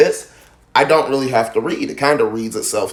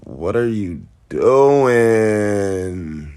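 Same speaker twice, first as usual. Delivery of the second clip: extremely drawn-out, low question; bored listener reaction; 4 semitones lower, much slower than usual. Acted. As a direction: drag every word out very slowly. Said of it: "I'm gonna be like".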